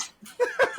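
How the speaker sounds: high laugh; laughing at his own joke; real burst of laughter. Real, not acted.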